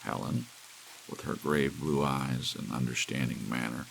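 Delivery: usual speaking voice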